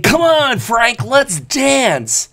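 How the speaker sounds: high, very slow, hammed-up invitation to dance; mock buddy invite. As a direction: lure your buddy onto the dance floor, drawn out. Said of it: "me grabbing my buddy and saying".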